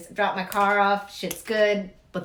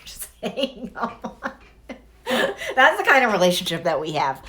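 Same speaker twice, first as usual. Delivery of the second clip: laughing; 3 semitones higher, 4 dB louder than usual. Real, not acted. Laughing about rude friendship.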